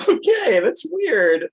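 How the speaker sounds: high, loud, thrown-off okay; startled reaction. Retold or imitated. Client reacting to the odd zaps, acted startle. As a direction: act out being zapped and unsettled.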